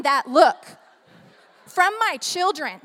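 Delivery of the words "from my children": ordinary talk, nothing marked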